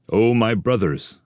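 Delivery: rally your companions; rousing call. Acted.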